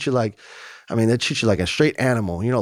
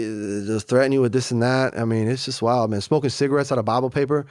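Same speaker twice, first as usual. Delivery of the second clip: ordinary delivery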